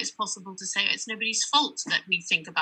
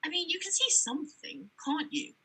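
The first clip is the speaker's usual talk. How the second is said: higher and softer, a coaxing question; probing guest; 8 semitones higher, 8 dB quieter than usual. Retold or imitated. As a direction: coax it out of her, high and soft. Guest presses about her sight.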